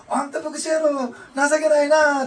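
playful Osaka mother chiding; Osaka dialect. Retold or imitated. Mother laughing at her pastor son, loud high Osaka-ben. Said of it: "って大阪弁ですね".

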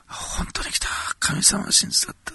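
breathless, rising with wonder; a joyful gasp of faith; overjoyed old believer. Retold or imitated. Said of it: "って。".

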